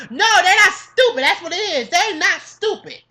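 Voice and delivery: loud, high, indignant denial; emphatic rebuttal. Real, not acted. Defending students against name-calling, emphatic and loud.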